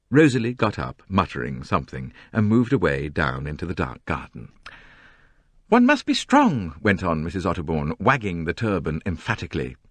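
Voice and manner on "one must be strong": much higher, louder, self-important whine; high, complaining society novelist. A narrator's reading. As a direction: complain grandly about the crowd. Mrs Otterbourne declaims.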